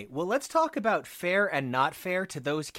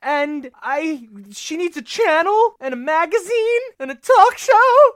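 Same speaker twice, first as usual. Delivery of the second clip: much higher and louder, nearly shouting; passionate fan; 10 semitones higher, 9 dB louder, slower than usual. Real, not acted. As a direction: demand it for her hero.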